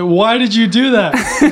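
high, slow mock protest through laughter; hammed-up indignant question. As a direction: imitate an upset villain. Villain's protest retold, laughing.

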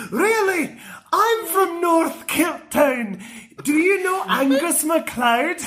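high, slow Scots voice; Willie suspicious. Retold or imitated. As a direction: test him like Willie. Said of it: "Willie goes".

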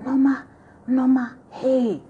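put-on high, urgent Nigerian-accented call of a name; Nigerian intonation. Schoolgirl bursting in on Norma, Nigerian accent calling. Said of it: "there's gonna be a lot of Nigerian intonations".

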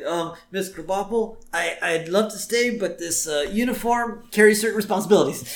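high, put-on schoolboy voice; acted self-important excuse, pitched high; mock earnest excuse. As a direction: feign regret to the teacher. Bart excusing himself from class.

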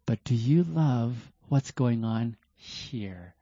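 slow, deliberate question. Shown as a demonstration. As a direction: take your time with every word. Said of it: "slow down that time frame and say".

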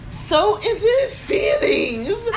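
slow, high laughing through words; cracking up while telling. Real, not acted. Laughing at the memory.

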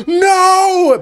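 high, drawn-out mock wail; hammed-up wail of horror; horrified crowd. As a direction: cry out like an appalled spectator. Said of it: "and they're like".

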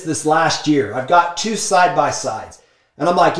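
ordinary delivery

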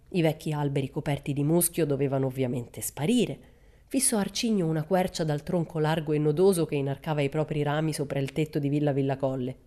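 ordinary delivery

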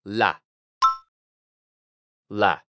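"La" with one plain L, low pitch; single-L syllable. Shown as a demonstration. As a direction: model "La" as a short English syllable. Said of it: "como si fuera solamente una". (sílaba "La" con una sola L, grave)

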